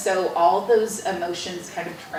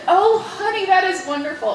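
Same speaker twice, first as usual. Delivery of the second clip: a light, much higher doting-dad voice; fond father; 11 semitones higher, 4 dB louder than usual. Retold or imitated. Father answering his daughter's news, doting. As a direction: coo it like a proud but distracted father.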